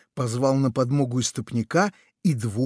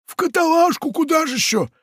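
very high, loud scornful answer; mocking pirate; 4 dB louder than usual. Pirate scoffs at the parrot, very high. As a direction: answer like it's obvious.

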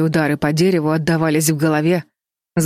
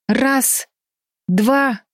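high, separated counts with pauses; urgent countdown; 8 semitones higher than usual. A narrator's reading. Бетти counts her sisters into the jump, urgent countdown. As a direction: count out each number as a signal.

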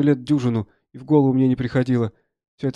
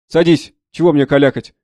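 loud, raised order; impatient doctor; 8 dB louder than usual. Acted. Doctor ordering the soldier down for amputation, loudly impatient. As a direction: cut the argument short. Doctor cuts the argument short.

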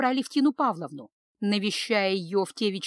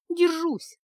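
sighed out, breathy and higher; sighing; 8 semitones higher than usual. Acted. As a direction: let it out on a sigh. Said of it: "вздыхала Аня".

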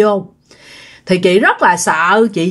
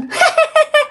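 high, eerie cackle; cackling laugh. Retold or imitated. Possessed sister-in-law suddenly laughing at the scared girl, cackling. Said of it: "bắt đầu cười ha hả lên".

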